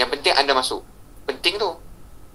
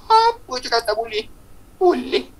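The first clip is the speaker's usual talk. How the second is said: very high, falsetto-like mock voice; high little put-on voice; playful squeaky voice; slower than usual. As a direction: pitch it way up for a comic reply. Contrasting playful answers of boleh.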